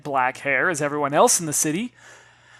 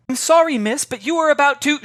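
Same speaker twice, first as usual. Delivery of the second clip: put-on girl apologizing; 11 semitones higher, 7 dB louder than usual.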